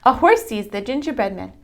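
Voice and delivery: usual speaking voice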